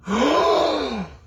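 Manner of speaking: high, theatrical shocked gasp; mock gasp. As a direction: gasp as if scandalised. Mock shock at dual citizenship.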